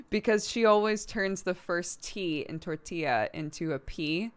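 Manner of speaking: ordinary talk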